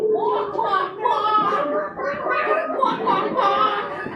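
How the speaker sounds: playful high quacking. Pretend. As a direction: quack like a silly bird. Chicken dance, imitating a bird.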